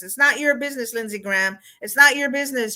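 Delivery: ordinary delivery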